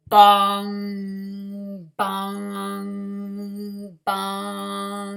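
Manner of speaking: deep ringing bong sounds; low, drawn-out bell tolling. Acted. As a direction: boom it out like a big church bell. Bell ringing under the nest.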